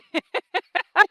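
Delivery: bright high burst of laughter; a quick real high laugh. Real, not acted. Laughs at own joke.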